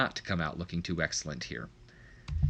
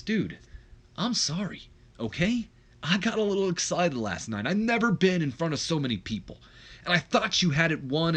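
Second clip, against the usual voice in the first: apologetic; 6 semitones higher than usual. Acted. Acted apology to angry brother.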